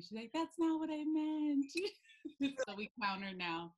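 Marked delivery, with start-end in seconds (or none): 0.3-1.6 high, loud, slow retort; playful imitation of being put out; mock indignant protest. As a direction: drag out an offended friend's protest. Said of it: "She was not happy".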